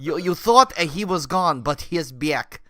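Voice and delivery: comic Russian showman; mock Russian accent. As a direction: announce it in a hammed-up Russian accent. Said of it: "turned off our entire Russian audience".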